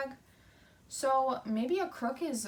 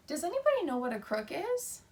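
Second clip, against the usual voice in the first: normal talking voice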